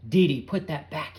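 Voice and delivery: loud sharp order; yelled. A narrator's reading. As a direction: bark the command. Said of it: "he yelled".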